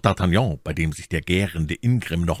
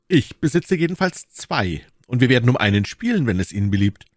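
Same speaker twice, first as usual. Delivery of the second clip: put-on high, spirited young gallant; challenging young gallant; 5 semitones higher than usual. D'Artagnan reminding the Englishman of their quarrel, challenging. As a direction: needle him, pitched up.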